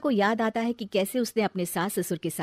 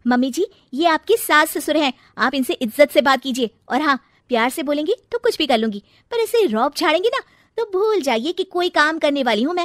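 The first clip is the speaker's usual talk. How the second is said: arguing back; 6 semitones higher, 7 dB louder than usual. Acted. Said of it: "Megha भी आशा से बहस करने लगती है".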